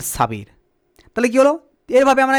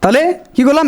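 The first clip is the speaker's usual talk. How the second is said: usual speaking voice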